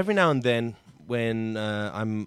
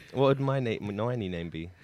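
ordinary talk, nothing marked

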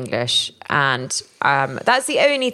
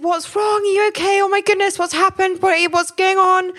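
high, anxious rush of worried questions; alarmed mother; 14 semitones higher than usual. Retold or imitated. Mother recalling her alarmed reply to her son. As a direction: sound panicked for your child.

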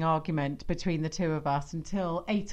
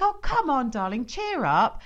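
lightly higher, coaxing sing-song; coaxing parents; 6 semitones higher, 4 dB louder than usual. Retold or imitated. Parents coaxing a sulky daughter, lightly high and coaxing. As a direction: cajole a sulky child, a touch high. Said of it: "We're just like".

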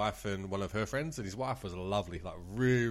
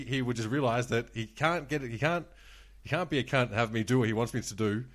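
usual speaking voice

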